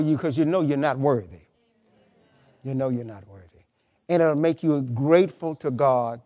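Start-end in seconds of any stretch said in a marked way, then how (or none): 2.6-3.6 dropped low and soft, as if to himself; soft repeat. Real, not acted. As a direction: let it sink in quietly, almost a murmur. Preacher repeats the line softly.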